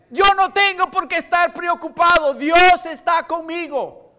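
high, loud, slow preacher's declaration; preaching. Acted. Pastor to congregation, shouting God's presence. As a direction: proclaim it to the back row.

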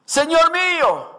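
sudden high cry of distress. A narrator's reading. Preacher voicing the desperate woodcutter, crying out. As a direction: cry out to the Lord in dismay. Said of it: "gritó diciendo".

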